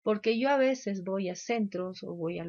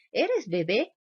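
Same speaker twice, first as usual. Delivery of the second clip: más fuerte, voz de niño que pregunta directo; niño burlón; 3 semitones higher, 6 dB louder, slower than usual. Retold or imitated. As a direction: imita a un niño sincero y burlón. Niño se burla de otro. (niño que se burla en el kínder)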